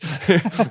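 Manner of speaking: sudden high cackle; high burst of laughter. Real, not acted. Laughs at own story.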